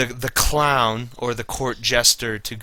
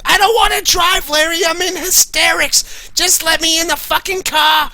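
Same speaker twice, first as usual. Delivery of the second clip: hammed-up hysterical Susie. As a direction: scream at Larry like a woman in hysterics. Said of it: "She's like".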